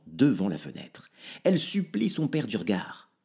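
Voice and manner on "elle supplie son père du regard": usual speaking voice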